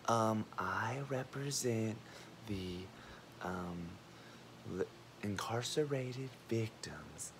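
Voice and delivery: hushed, halting, half-whispered imitation; mock super-quiet speaker. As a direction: mimic a timid speaker, very slow and barely audible. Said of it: "he's super quiet".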